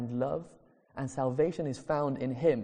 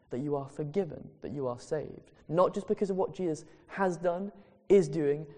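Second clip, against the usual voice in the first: ordinary talk, nothing marked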